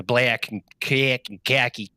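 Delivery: exaggerated Chicago short A; mock a Chicagoan's stretched vowels; hammed-up Chicago accent; gross Chicago accent. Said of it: "she had the grossest accent".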